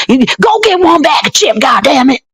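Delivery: high hammed-up yell at the child; mock shout at kid. Mom yelling at her kid in the store, hammed-up high shout. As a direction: bark the order like an angry mom. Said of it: "screaming at their kids".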